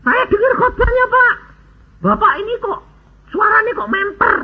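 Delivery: high-pitched imitation of an amazed seller; puzzled recognition. Car seller recognising the preacher's voice, puzzled and amazed.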